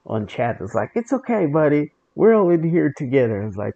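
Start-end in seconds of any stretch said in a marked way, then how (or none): 0.9-3.4 much higher, sugary mock-kind voice; imitating a two-faced raider typing cute messages; fake sweet. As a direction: coo it with exaggerated fake kindness. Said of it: "you're being so fake sweet".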